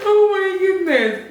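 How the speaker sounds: loud, high laughing exclamation; loud laughing oh my goodness. Real, not acted. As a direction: gasp it out mid-laugh. Laughing hard after joke.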